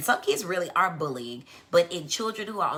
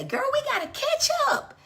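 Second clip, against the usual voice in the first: put-on very high girlfriend gush; gushing promise; 3 dB louder than usual. Friend promising a girlfriend to catch up, gushing and high.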